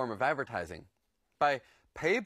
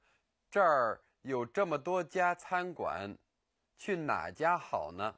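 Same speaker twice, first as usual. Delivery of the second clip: slow; slower than usual. Shown as a demonstration. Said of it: "For example".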